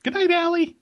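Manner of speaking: very high, squeaky cartoonish goodnight; silly sign-off. Co-host told to say goodnight, silly high voice. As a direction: squeak out a mock goodnight in falsetto. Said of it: "So say goodnight, Allie".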